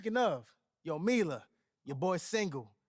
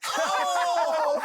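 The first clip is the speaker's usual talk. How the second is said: loud burst of laughing Oh!; howling Oh! mid-laugh; laughing shout; 11 semitones higher, 8 dB louder than usual. Acted. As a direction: whoop it out while cracking up. Cracking up at the joke.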